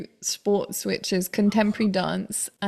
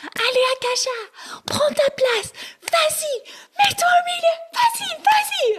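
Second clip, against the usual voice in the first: much higher mock shout of the dance teacher; teacher urging in French. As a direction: push her to the middle, shouting encouragement. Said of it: "she's like".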